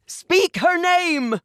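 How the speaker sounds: high, slow, ringing command; ceremonial command. Acted. Matriarch commanding at the naming rite, high slow ringing call. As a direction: draw the command out to the crowd.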